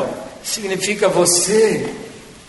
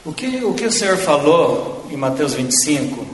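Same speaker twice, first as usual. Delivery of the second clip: ordinary talk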